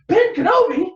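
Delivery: loud, high-pitched amazed question; astonished echo. Retold or imitated. Leia hearing who came to rescue her, astonished. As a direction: shout the name back in mock amazement.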